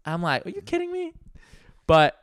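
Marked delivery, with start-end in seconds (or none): 0.5-1.1 disbelief. Retold or imitated. Crowd suddenly appears.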